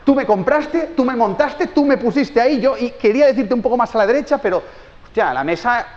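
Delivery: high, loud protesting voice of a wronged table; an object pleading its innocence; indignant table. Pretend. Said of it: "la mesa ahí en plan". (la mesa se defiende de la madre, protesta aguda e indignada)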